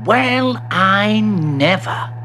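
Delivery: high, slow amazement; gasping astonishment. Acted. Bertie amazed by the lift, drawn-out gasping surprise. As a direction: draw it out in wonder. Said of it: "gasped Bertie".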